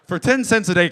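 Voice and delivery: loud, high shouted ad line; hammed-up yelled sales pitch; rowdy celebrity pitch. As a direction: holler the charity appeal. Rowdy celebrity parodies dog ad.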